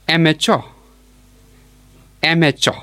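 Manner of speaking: "amateur" as EM-eh-cho, stressed first syllable; model pronunciation. Shown as a demonstration. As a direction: model "amateur" as EM-eh-cho, pausing between repeats. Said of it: "It is pronounced as".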